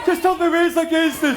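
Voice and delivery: very high character yell; strained high-pitched shouting; shouted catchphrase. Acted.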